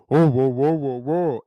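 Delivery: loud, booming string of whoas; hammed-up big-man impression; mocking bassy voice. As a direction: imitate a wife mocking her husband's voice. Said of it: "a lot more bass and".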